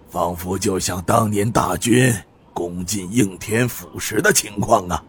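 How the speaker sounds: sighing lament. Acted. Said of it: "不由得叹声道".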